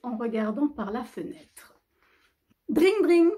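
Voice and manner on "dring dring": high, loud telephone "dring dring"; imitated phone ring. Phone interrupts Noël in the story, loud imitated ringing. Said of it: "fit soudain le téléphone".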